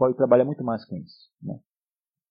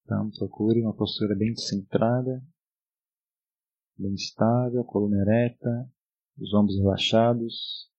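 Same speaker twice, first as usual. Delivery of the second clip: soothing spa whisper; 3 semitones lower than usual. Acted. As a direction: ease them gently into stillness. Leads a meditation.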